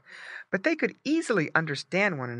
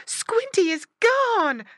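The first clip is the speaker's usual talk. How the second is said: high, slow wail of alarm; loud, high distressed pig voice; frightened mother pig cry; 6 dB louder, slower than usual. A narrator's reading. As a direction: cry out as a panicked mama pig. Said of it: "cried Missus Pig".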